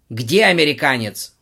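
loud roar. Acted. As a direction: roar the demand. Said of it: "взревел Игорь Викторович". (рёв)

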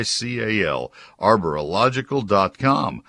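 ad read. Acted. Ad read with phone number.

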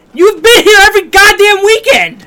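high mock outraged yell; shouting. Real, not acted. Yells at co-host.

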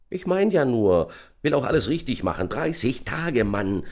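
high, gleeful chatter; bubbly, pleased, high-pitched; cheerful calling. A narrator's reading. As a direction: call out happily to a mate. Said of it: "rief er vergnügt".

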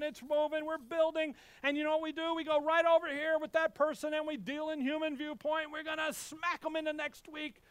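high, driving, breathless-paced build-up; hyped-up preaching. Acted. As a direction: rattle it off with mounting mock enthusiasm. Hypes up congregation's progress, mockingly.

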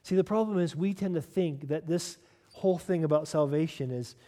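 usual speaking voice

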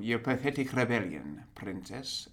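lightly voiced clipped British villain; a hint of Tarkin's clipped sneer; clipped Imperial tone. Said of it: "rolls his R's".

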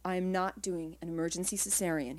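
ordinary delivery

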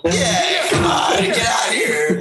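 exasperated shout. Real, not acted. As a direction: shout it out in disbelief. Outraged at DC statement.

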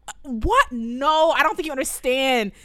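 high, loud overwhelmed teacher; loud, high-pitched comic outrage; exasperated rant. Acted. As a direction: rant as if nobody understands your burden. Acted outburst of overwhelmed violin teacher.

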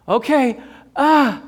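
high, loud mock-scared yelp; reluctant jumper. Scared jumper finally leaps, mock yelp. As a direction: give in, then cry out high. Acts jumping off the dive.

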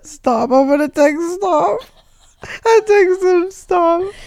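high, loud giggly speech; laughing while telling it. Real, not acted. Giggling after texting stop.

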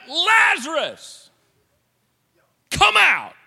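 Jesus roaring at the grave; let it rip shout. Retold or imitated. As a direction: bellow the call across the tomb. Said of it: "Jesus let it rip".

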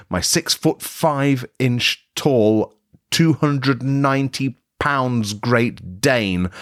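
drawn out slowly, word by word. Acted. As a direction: stretch each word for comic effect. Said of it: "this is the point at which it begins".

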